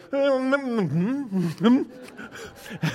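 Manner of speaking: high, drawn-out "mm" sounds; imitated baby tasting noises. Dad imitating his son tasting ice cream, wordless murmurs. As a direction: mimic a baby savouring a first taste. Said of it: "and he went".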